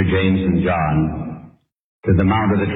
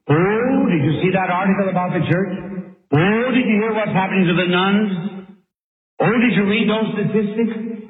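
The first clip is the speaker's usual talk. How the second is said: high, fretful busybody passing on rumours; much higher pitch, eager alarmed chatter about the news; gossiping worrier; 10 semitones higher than usual. Acted. Preacher mimicking Peter fretting over popular opinion, high gossipy voice. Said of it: "In other words, he began to say".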